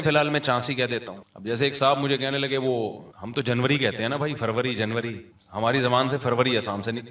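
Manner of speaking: normal talking voice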